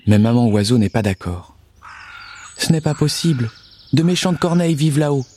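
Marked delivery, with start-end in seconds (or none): 2.6-5.2 higher, fretful mother bird; worried little bird voice. Acted.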